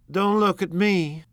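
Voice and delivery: high, slow, indignant retort; sharp retort. Acted. Daisy to Jordan, retorting high and slow. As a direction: toss it back, slowly. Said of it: "Daisy retorted".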